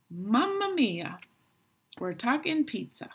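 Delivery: high, loud hammed-up Italian chef; jolly Italian pizza chef. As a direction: belt it like a cheery pizza chef. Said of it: "pretend we're talking pizza".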